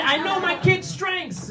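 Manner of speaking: high, drawn-out mock shout; defensive shout. Parent of a stuttering kid defending himself, high mock shout.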